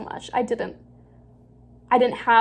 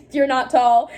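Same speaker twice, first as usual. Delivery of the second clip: laughing; 3 semitones higher, 6 dB louder than usual. Real, not acted. Reading then mocking herself.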